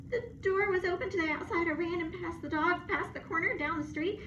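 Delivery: high, rushed, near tears; breathless dog. Acted. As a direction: pour out the scary story fast. Dog blurts his story.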